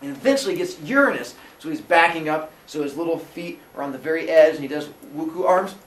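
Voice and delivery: normal talking voice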